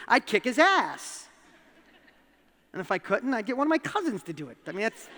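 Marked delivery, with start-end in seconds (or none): 0.1-1.3 loud, slow emphatic threat; dad vowing to defend daughter, fierce; protective threat. Real, not acted. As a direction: hit each word like a promise.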